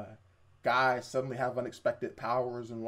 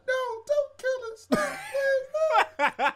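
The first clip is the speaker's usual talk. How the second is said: put-on very high, slow, wailing girl voice; mock pleading; 5 dB louder, much slower than usual. Imitating a girl begging for a dying cat's life, pleading. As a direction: beg in a hammed-up falsetto.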